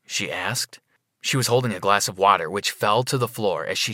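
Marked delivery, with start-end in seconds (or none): none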